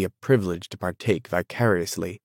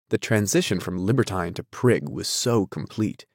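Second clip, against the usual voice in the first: plain reading voice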